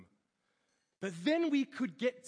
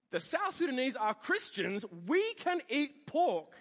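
raised, emphatic objection slowing at the end; insistent protest; 6 semitones higher, 5 dB louder than usual. Retold or imitated. Lecturer argues with friend about eating pork, insistent. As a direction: argue back, louder and higher. Retells himself arguing with Steve.

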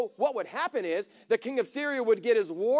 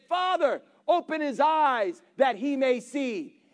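raised, slow earnest plea to God; louder, slower pleading prayer; fervent prayer; 2 semitones higher, 3 dB louder, slower than usual. Retold or imitated. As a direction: call out to the Father with urgency. Elisha prays aloud for Gehazi.

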